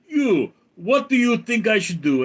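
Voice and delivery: put-on high, loud Russian-accented demand; single out an advisor in Russian accent; Putin. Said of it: "and he goes".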